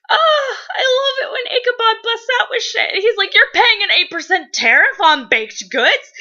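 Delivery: high, loud squealing rant with laughs; gushing fangirl. Real, not acted. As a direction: gush over Ichabod, shrieking happily. Gushing about Ichabod with laughs.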